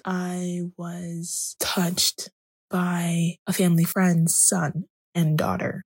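ordinary delivery

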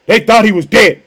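ordinary talk, nothing marked

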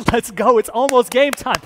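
loud hyped-up team in the tunnel; pre-game hype. Acted. Disciples like players in the tunnel, loudly psyched up. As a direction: pump up the team before kickoff. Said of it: "they must just be, like, in the tunnel".

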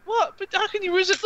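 very high, disbelieving coo; incredulous cooing. Acted. Player urges another to pet the dog, high cooing. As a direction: squeal it high, pleading the dog's case.

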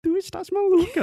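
high, loud mock Portuguese accent from Portugal; European Portuguese accent. Husband teasing wife in a hammed-up Portuguese accent. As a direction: ham up a Lisbon accent, way up high. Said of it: "a voz ficando mais fina".

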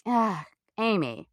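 slow, sighing letdown of a teacher; disappointed teacher. Retold or imitated. Teacher sighing at a student who cried in class, slow and letdown. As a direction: let the name sag with mild disappointment.

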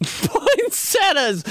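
high, slow drawn-out Poinsettias; laughing exclamation. Real, not acted. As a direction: stretch it out laughing. Laughing outburst.

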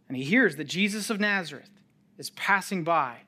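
normal talking voice

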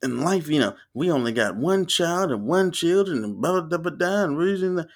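high, chanting mock preacher cadence. Preacher going in on that podium, mock preaching. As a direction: rattle on in a sing-song sermon parody. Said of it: "he was going in on that podium".

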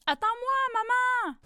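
high, loud call after his mother; child crying out. A narrator's reading. Child calling to his mother through the storm, loud high cry. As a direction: shout for mum to wait. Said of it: "un enfant qui crie".

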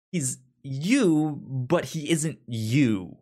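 ordinary delivery

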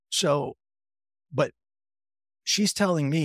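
usual speaking voice

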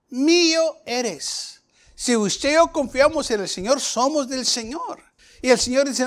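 high, drawn-out scripture proclamation; emphatic preaching. Acted. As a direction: preach it with sustained, stretched words. Preaches scripture at full force.

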